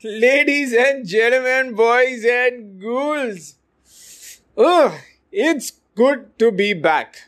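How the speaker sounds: high, slow spooky showman voice; slow Halloween pumpkin host welcoming; jack o lantern voice. Acted. Spooky Halloween-style podcast comeback greeting.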